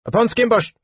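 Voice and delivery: high, gabbled gnome talk; rushed gnome. Acted. Gnome rushes the room name, high and gabbled. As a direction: spit it out fast and high like a gnome.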